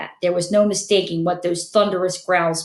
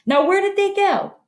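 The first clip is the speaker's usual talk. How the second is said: much higher, demanding shout; angry demand; 12 semitones higher than usual. Acted. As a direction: demand it with rising fury. Filch losing patience.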